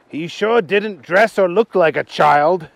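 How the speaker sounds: slow, lightly voiced Archie; Archie's voice. Archie doubting the man is a child, lightly voiced. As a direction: drag it out as Archie. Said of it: "said Archie".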